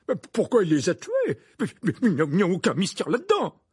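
loud, stammering, flustered protest; exclaiming. A narrator's reading. Minister dismisses any mystery, exclaiming. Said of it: "s'exclama Fudge".

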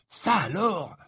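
impressed. Acted. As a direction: sound deeply impressed. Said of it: "répéta Ron impressionné".

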